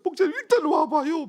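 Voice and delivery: high, loud mock wailing; imitated crying plea. Woman deacon urging the pastor to come over, imitated crying plea. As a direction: beg tearfully in a high put-on voice. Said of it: "막 울어요".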